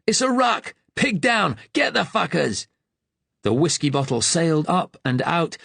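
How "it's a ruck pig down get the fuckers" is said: high, raucous yell across the street; rally the mates to brawl; drunken shout. Acted. Drunk youth calls his mates into the brawl, yelling. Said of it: "One shouted".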